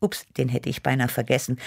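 ordinary delivery

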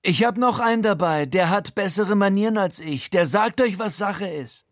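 high, cocky gangster voice; großspurige Ansage. A narrator's reading. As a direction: announce your accomplice with swagger. Frankie kündigt großspurig Guido an. (hohe, angeberische Gaunerstimme)

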